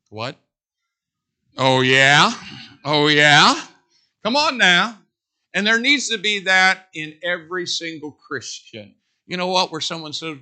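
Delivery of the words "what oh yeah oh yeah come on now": loud rising challenge, cocky; defiant David. Retold or imitated. Young David hearing Goliath's boast, loud defiant challenge. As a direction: answer Goliath's taunt with a dare. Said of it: "a part of him that went".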